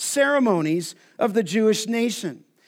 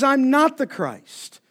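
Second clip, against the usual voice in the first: slow, higher, firm "not"; emphatic denial; 4 semitones higher, slower than usual. Retold or imitated. John insisting to questioners, emphatic. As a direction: stress "not" in "I'm not the Christ". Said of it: "wants to be emphatic".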